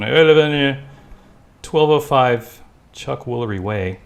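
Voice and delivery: over the phone. Pretend. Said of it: "You have to say over the phone".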